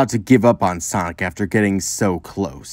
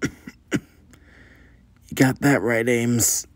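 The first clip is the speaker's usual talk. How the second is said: weak voice; 2 semitones higher, 4 dB quieter, much slower than usual. Acted. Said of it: "A weak voice came across the room".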